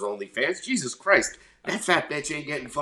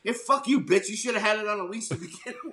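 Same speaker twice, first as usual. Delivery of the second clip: mock telling off; 11 semitones higher, faster than usual. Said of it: "you're like".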